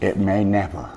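low, soft mentor voice; deep, hushed imitation of a mentor; imitating his mentor. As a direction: drop it low and quiet, like an elder. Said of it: "That's how he talks".

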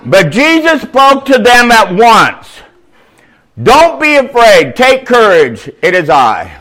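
booming preacher reading the Bible aloud; loud scripture reading. Acted. Preacher reads the gospel to his congregation, booming. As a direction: proclaim the passage to the whole congregation.

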